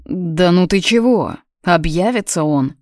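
usual speaking voice

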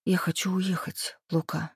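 soft, hushed confession; whispered. A narrator's reading. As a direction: say it barely above a breath. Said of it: "вдруг прошептала я".

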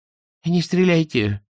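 high, drawn-out plea; pleading. A narrator's reading. Captured creature begs Svintsov not to shoot, pleading. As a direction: beg slowly in a high creature voice. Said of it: "снова взмолился спустившийся".

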